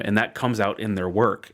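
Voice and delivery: usual speaking voice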